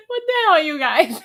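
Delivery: high, loud laughing cry; laughing outburst. Real, not acted. Streamer cracking up at a viewer's comment. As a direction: laugh it out in disbelief.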